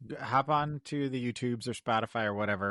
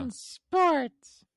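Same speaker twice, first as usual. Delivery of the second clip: high, loud puppet voice; Elmo-like voice; 5 dB louder than usual. Pretend. Co-host repeating the segment tag, high puppet voice. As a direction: pipe it up like a little puppet. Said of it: "that was like a sexy Elmo".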